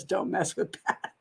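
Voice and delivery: low, soft words dissolving into laughter; slow, quiet, helpless laugh through the words. Real, not acted. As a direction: break up laughing as you read it. Laughing while reading a viewer's comment.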